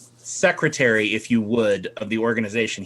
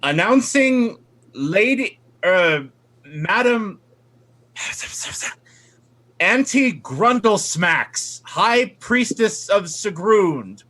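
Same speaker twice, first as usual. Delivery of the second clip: slow, high, grand herald; formal announcer; 7 semitones higher, slower than usual. Acted. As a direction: announce her like a pompous herald. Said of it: "And then the deacon says".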